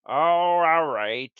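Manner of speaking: ordinary delivery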